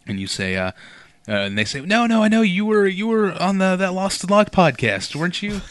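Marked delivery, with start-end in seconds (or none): 1.8-5.6 loud, high, insisting fan; insistent fan. Pretend. Grocery store fan insisting he knows the podcaster, loud and high. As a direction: push it like you're sure you know him.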